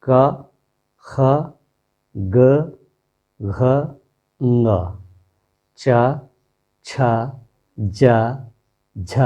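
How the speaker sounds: each consonant said with its a vowel, one by one with pauses; reciting consonants. Shown as a demonstration. Teacher reciting the Hindi consonants to students, each with its a sound. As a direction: read out every consonant clearly for the class. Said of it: "पूरा व्यंजन में पढ़ रहा हूँ".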